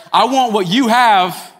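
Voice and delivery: mock envious whine, slow; slow envious quote. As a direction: stretch it out like coveting. Envious voice acted out.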